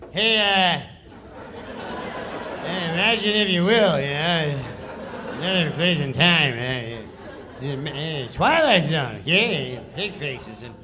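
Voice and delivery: loose, laughing, half-hearted narrator read; Norm's lazy read. Retold or imitated. Comic retelling Norm's careless read of the narrator. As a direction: mumble the intro like you barely care. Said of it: "this is how he reads the sketch".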